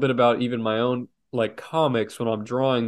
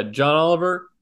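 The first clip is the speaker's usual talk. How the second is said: usual speaking voice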